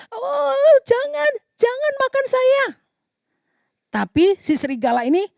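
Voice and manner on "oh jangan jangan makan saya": high frightened plea; little sheep squealing for mercy; pleading in fear. Acted. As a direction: beg not to be eaten, voice up high. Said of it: "dia bilang".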